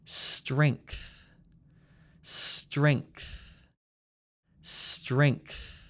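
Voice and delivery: "Strength" with throat ng moving up to th; NGTH ending. Shown as a demonstration. Teacher models for repeating, "Strength" with ng plus th. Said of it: "the n sound comes from your throat".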